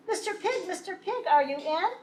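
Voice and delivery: high sing-song call; wolf calling at the stick house. Acted. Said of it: "I called".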